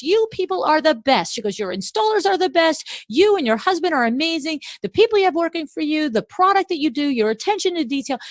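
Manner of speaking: high-pitched, eager string of praise; retold customer heaping praise, excited; gushing customer. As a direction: pile on the praise like an excited fan.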